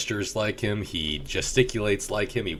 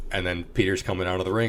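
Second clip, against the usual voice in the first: usual speaking voice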